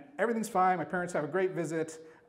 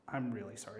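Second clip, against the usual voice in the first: soft, low retold apology; hushed apology; 8 semitones lower, 9 dB quieter than usual. Coworker apologizing after the crash, hushed and low. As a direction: drop low and quiet to apologize.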